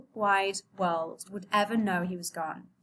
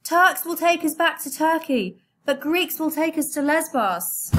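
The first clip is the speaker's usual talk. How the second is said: loud, higher voice over the engine; shouting over machinery; 8 semitones higher, 5 dB louder than usual. Acted. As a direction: keep yelling above the motor. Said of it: "shouted over the noise".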